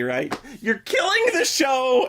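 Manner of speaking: ordinary talk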